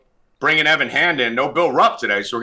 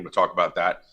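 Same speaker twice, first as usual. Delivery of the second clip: normal talking voice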